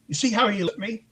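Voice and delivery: bully voice. Retold or imitated. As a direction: mimic a schoolyard bully's complaint. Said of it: "like a middle school bully".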